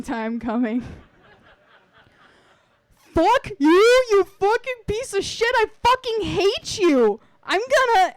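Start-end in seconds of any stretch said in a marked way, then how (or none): 2.9-8.1 very high, very loud furious scream; screaming in rage. Acted. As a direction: scream at him with all your hatred. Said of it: "the screaming maniac".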